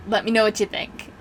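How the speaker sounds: normal talking voice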